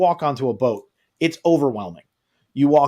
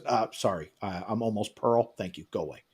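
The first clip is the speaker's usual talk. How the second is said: quiet brush-off; 7 semitones lower, 10 dB quieter than usual. Retold or imitated. Retold dismissive brush-off.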